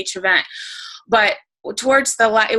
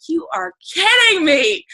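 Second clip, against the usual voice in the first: disbelief; 7 semitones higher than usual. Retold or imitated. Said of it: "And I'm like".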